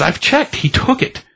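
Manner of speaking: a touch of exasperated insistence; mildly voiced frustrated heroine; insistent. Jessica insists the thief took it.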